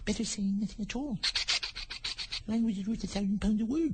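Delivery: high, soft passenger voice; soft, high chorus chant. Acted. Passengers think in chorus, varied voices.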